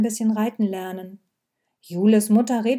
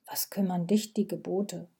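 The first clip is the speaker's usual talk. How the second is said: hushed, near-whispered retort; 2 semitones lower, 8 dB quieter than usual. A narrator's reading. Viktoria answering Vati back about the commandments, whispering. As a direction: say it very softly, half under the breath. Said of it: "flüsterte Viktoria".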